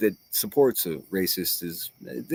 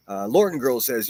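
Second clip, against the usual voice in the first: usual speaking voice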